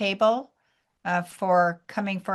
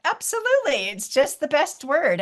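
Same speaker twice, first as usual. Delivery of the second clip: emphatic agreement; 8 semitones higher, 4 dB louder than usual. Retold or imitated. Her emphatic reply.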